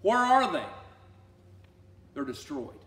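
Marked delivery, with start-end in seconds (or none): 0.0-0.7 God taunting. Acted. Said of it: "He's like".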